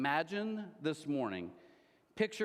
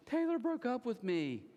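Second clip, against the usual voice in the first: mock bawling; 8 semitones higher than usual. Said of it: "she's just bawling".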